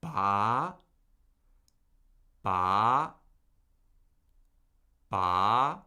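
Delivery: "Ba" gliding steadily upward; questioning upward lift on Ba; second tone. Shown as a demonstration. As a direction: start low and rise through each "Ba". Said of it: "let's try that with a syllable".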